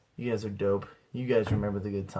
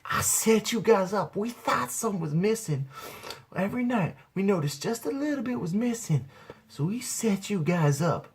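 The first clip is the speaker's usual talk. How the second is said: accusing grandma; 8 semitones higher than usual. Retold or imitated. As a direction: imitate grandma revealing her trap. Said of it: "It's like".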